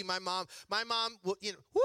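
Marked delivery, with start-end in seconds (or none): 1.7-1.8 high hooting woo; comic imitation of a revival holler; imitated cheering whoop. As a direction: whoop like mom at a camp meeting.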